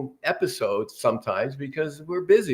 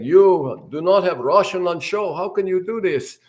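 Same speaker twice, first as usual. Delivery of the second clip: put-on funny Russian accent; 6 semitones higher, 3 dB louder than usual. Gene quoting the Russian news agency, mock Russian accent. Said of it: "he kinda did it in a funny accent".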